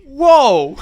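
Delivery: loud, high delighted whoa; excited whoa. Real, not acted. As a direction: blurt it out in delight. Reacting to hot demon.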